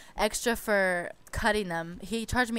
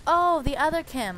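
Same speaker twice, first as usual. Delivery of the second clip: high, loud "oh" of remembering; imitating the salon guy's sudden recall; mock sudden realization; 7 semitones higher, 5 dB louder than usual. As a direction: play the guy catching on too late. Nail guy remembers another Kim.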